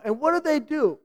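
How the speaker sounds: ordinary talk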